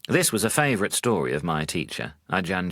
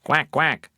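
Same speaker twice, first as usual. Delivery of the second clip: duck quack; 8 semitones higher, 4 dB louder than usual. Acted. Duck sound at the end.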